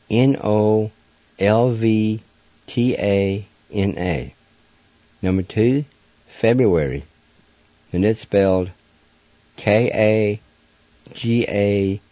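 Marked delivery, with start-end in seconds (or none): none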